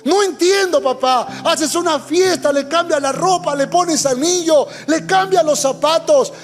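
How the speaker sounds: put-on resentful older brother. Preacher voices resentful older brother.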